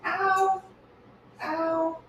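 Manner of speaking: sharp high yelps of pain. Real, not acted. Burned by hot water, yelping ow. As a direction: yelp as the hot water burns.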